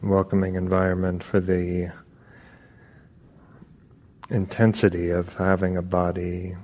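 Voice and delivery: unhurried meditation guidance with long pauses; calm, even voice, drawing out the phrase endings; guided meditation. Acted. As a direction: ease them gently into stillness, phrase by phrase.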